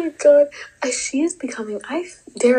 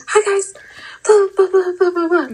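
peppy greeting; 10 semitones higher, 6 dB louder than usual. Retold or imitated. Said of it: "I'm like".

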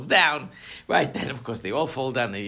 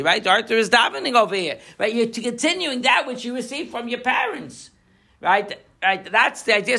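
higher, louder sermon voice building to a point; fervent preaching; 10 semitones higher, 7 dB louder than usual. Acted. Rabbi driving home the meaning of stones, fervent preaching. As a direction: preach it to the congregation with full conviction.

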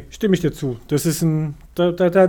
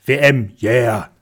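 sarcastic cheer; 3 semitones lower, 5 dB louder, slower than usual. Retold or imitated.

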